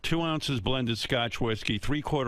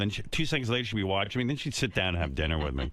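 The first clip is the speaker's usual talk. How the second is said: normal talking voice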